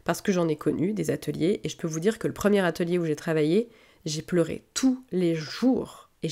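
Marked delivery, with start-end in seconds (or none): 4.0-6.1 slow breathless whisper, pained. Shown as a demonstration. Recalling crying daily at first workshop, whispered slowly. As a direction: hush it out slowly, as if confessing. Whispered, then repeated plainly.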